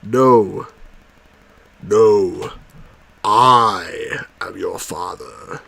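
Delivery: slow, low dramatic reveal; mock Vader delivering the reveal; Darth Vader line. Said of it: "He says".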